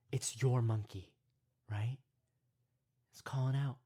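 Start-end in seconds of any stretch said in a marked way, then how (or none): none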